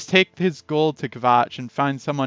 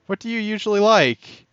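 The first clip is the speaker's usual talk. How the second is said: lifted, higher voice for the woman; higher character voice; 7 semitones higher than usual. Acted. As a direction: raise the pitch to play her. Shopkeeper voiced asking customer.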